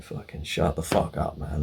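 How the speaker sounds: low, slow, weary grumble under the breath; muted exasperation. Retold or imitated. Customer sat beside accusing mechanic, quiet exasperated aside. His silent exasperated thought in car.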